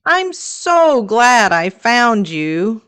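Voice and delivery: usual speaking voice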